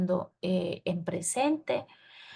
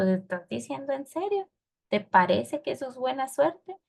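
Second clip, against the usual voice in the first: higher, disbelieving questions; incredulous, raised-pitch questioning; doubtful questioning; 5 semitones higher than usual. Shown as a demonstration. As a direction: ask as if checking they really mean it. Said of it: "yo le puedo estar preguntando también".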